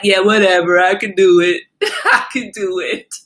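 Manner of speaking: loud, laughing defiance; defiant brag. Retold or imitated. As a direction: brush it off and boast loudly. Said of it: "And I'm like".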